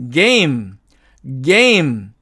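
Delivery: "게임" drawn out with a voiced hard G; voiced initial G. Shown as a demonstration. Teacher reads it for learners, careful voiced G. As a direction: model "게임" slowly, falling, G hummed first. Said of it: "다시 한번 읽어볼게요".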